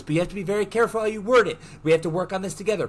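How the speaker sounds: rapid-fire retold plea; urgent pleading. Bear begging his friend to word it carefully, urgent.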